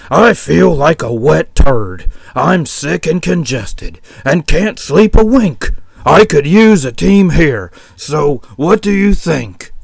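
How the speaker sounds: sick, fed-up sheep complaining to the boss; grumbling sick sheep. Acted. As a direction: grumble loudly about being ill. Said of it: "he grumbled".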